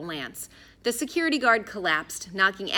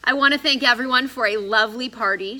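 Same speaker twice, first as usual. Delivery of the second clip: cheeky farewell; 4 semitones higher, 8 dB louder than usual. A narrator's reading. Addison hauled out, cheeky.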